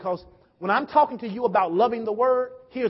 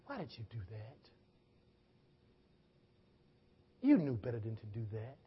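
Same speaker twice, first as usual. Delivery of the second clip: low, very soft voice of conscience; hushed rebuke; 11 semitones lower, 13 dB quieter than usual. Retold or imitated. Voice heard by one who disobeyed God, hushed rebuke. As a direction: reproach quietly, with a long pause midway.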